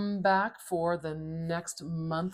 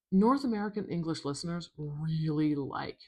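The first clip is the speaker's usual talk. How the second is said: ordinary talk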